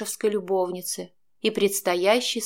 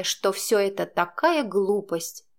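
plain reading voice

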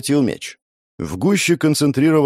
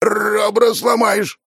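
strained shout; 12 semitones higher, much slower than usual. Acted. Crushed in a bear hug.

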